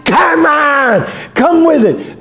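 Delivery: high, loud scream, slow on the first line; defiant scream. Retold or imitated. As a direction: scream at the storm to bring it on. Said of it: "And he said".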